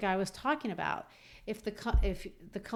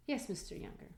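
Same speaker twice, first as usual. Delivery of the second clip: quiet impatient; 3 semitones higher, 7 dB quieter than usual. Retold or imitated. Linder's impatient reply.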